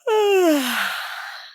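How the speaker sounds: long high yawn; yawning sigh. Real, not acted. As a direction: let out a big yawn. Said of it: "can you just do a yawn".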